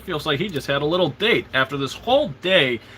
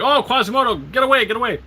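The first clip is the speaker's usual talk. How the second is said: shooing crowd; 8 semitones higher, faster than usual. Retold or imitated. Everybody shooing Quasimodo away.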